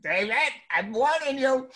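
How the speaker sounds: high, loud warning mother. Retold or imitated. Mother warning her son through the door, high mock threat. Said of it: "my mother goes".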